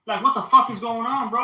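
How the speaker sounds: loud, high, incredulous exclamation; exasperated outburst. Real, not acted. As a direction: burst out in real exasperation. Outburst over a trend.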